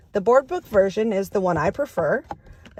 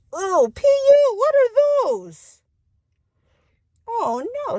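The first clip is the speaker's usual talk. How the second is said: high, loud mock disgust at the stink; hammed-up yuck at a smell; 14 semitones higher, 3 dB louder than usual. Skunks arrive, reader reacts.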